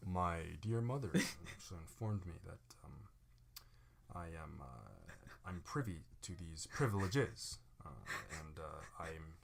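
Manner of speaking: slow, low, hushed formal voice; deliberate, halting mock-dignified speech; pompous gentleman. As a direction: play the soft-spoken aristocrat. Acts the mature formal version.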